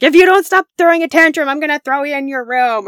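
mock furious parent threat; imitating a parent losing their cool; yelling threat. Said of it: "We'll yell, we'll threaten".

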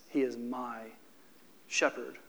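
hushed reverent quote. Real, not acted. Preacher quoting David's words to the congregation, hushed and reverent. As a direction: say it like a secret you treasure. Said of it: "David says".

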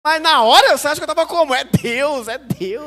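loud, high gushing; mock-thrilled about the TV offer; overexcited. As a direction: gush like he already believes it. Comic excitement retold.

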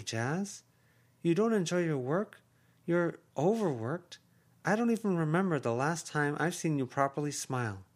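voiced aunt, high and urging; effusive aunt. Aunt Heather urges her sister.

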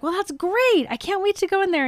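high, excited and oblivious; eager delight. Acted. Man thrilled to go in and meet her, eager.

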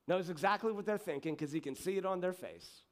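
ordinary delivery

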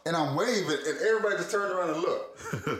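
usual speaking voice

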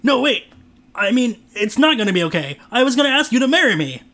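desperate plea. Acted. Trent begging Kate as she drives off, desperate plea. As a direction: beg her to stay before she drives off.

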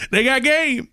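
high-pitched kid imitation; little kid's accent and voice. Eight-year-old with basketball asks speaker, imitated kid voice. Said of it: "he says it just like that".

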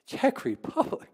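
incredulous. Retold or imitated. Hearing they'll drive to Czechia.

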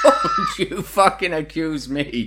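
slow, hammed-up shout through laughter; mock furious yell. Mock Keane shouting at Ferguson, laughing fury. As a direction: bellow it in a laughing, mock rage.

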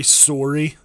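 "sorry" with a rounded Canadian "soar-y"; mock Canadian vowel on sorry; Canadian accent. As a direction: lightly tease the Canadian "sorry". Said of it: "I like Canadians".

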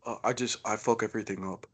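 lightly voiced, mumbled, glum tough guy; a hint of a big sad brute owning his mistakes; barely-there mock Bautista, kept soft; Dave Bautista impression. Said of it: "let me play Dave Bautista".

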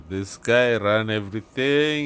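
normal talking voice

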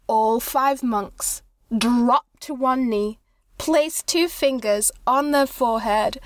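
ordinary talk

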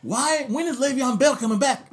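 eager fan question. Shown as a demonstration. Cohost asks a coworker about the running back, lively. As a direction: pitch it high like a curious fan. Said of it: "There you go".

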